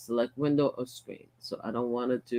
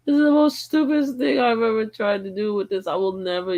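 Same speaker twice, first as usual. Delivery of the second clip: much higher and louder, fed-up outburst; exasperated rant; 9 semitones higher, 6 dB louder than usual. Real, not acted. Streamer ranting to viewers about the app, exasperated outburst. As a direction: vent it, fully fed up.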